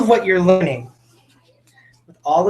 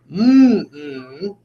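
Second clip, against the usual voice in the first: two hummed beats, mouth closed; hummed syllables. Shown as a demonstration. Teacher humming "chicken" to show its beats. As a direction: hum the word in two beats. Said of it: "and I go".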